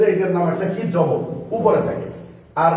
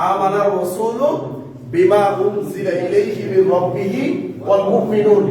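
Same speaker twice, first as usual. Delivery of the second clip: slower, each word of আমানার রাসূল বিমা উনজিলা drawn out; slow recitation. Shown as a demonstration. Teacher to the class, repeating the verse slowly. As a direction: recite it again slowly so the class can follow. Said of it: "তো দেখেন, আমরা".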